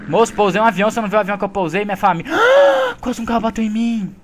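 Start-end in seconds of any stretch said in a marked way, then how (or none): 2.4-3.0 sharp gasp. Real, not acted. Near miss by cars.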